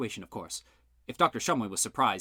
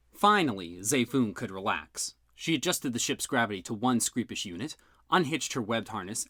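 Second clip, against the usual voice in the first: usual speaking voice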